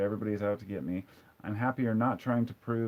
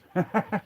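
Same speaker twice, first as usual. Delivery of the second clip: short high-pitched laugh; 10 semitones higher, 3 dB louder than usual. Real, not acted. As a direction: let out a brief, high laugh. Amused by mushroom comment.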